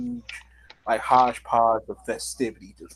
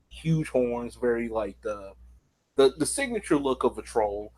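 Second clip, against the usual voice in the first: ordinary talk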